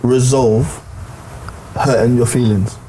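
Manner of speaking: loud, slow, deliberate question; insistent talking over. Real, not acted. Man cutting in to ask how one resolves hurt feelings, loud and insistent. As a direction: space out the question and push the volume hard.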